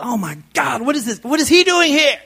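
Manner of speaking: oh no, he's here; panicked surprise. Retold or imitated. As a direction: caught off guard, voice climbing. Panicked retold reaction.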